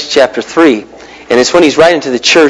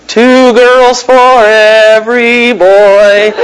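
drawn out; 3 semitones higher, 3 dB louder, much slower than usual. Acted. Stretched emphasis on the ratio.

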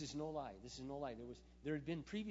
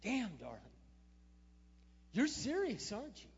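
surprised; 9 semitones higher, 9 dB louder than usual. Retold or imitated. Coworker voiced in disbelief.

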